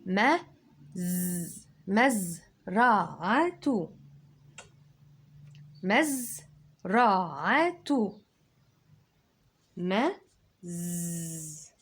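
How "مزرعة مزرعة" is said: مزرعة drawn out very slowly, louder; slow syllables. Shown as a demonstration. Teacher sounding out مزرعة for kids. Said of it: "سكون اهي".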